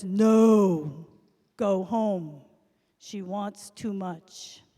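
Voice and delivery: a firm magic fish turning him away; booming no, then a slow final verdict; stern refusing fish. Acted. Fish refuses the last wish.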